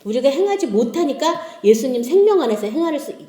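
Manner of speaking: usual speaking voice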